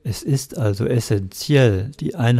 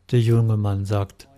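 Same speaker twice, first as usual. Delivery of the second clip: ordinary talk, nothing marked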